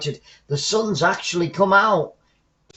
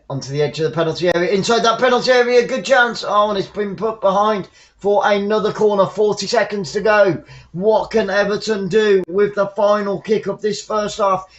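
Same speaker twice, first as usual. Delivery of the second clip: higher, louder, fast live commentary; excited play-by-play; 5 semitones higher, 4 dB louder than usual. Acted. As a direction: build the tension as the ball goes in.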